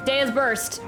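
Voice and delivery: ordinary talk, nothing marked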